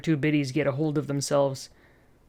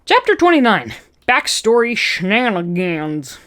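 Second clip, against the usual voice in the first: announcing; 4 semitones higher, 4 dB louder than usual. A narrator's reading. Chapter heading.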